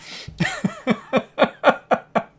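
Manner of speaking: high, real giggling fit; long laugh. Real, not acted. Reader cracks up at own limited French, laughing. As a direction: laugh in a run of high bursts.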